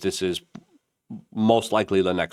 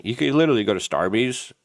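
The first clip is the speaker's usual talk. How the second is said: usual speaking voice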